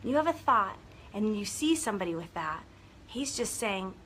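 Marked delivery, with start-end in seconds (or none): none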